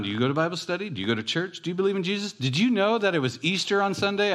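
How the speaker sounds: eager high-pitched rapid-fire questions; bubbling over, pitch jumping up; excited. Retold or imitated. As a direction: imitate an excited man quizzing everybody. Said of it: "I mean, he was excited".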